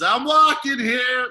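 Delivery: high, loud, stretched-out holler; hammed-up slow yell; Uncle Vinny's catchphrase. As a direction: burst in with a cartoon uncle's catchphrase. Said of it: "Uncle Vinny goes".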